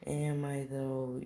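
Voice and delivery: high, slow, drawn-out teasing question; smugly questioning. Retold or imitated. Mentor answers those doubting him, mock smug challenge. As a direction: stretch it out high, as a knowing challenge.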